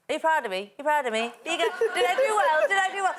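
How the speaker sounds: needy daughter. Retold or imitated. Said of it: "I constantly ask my parents".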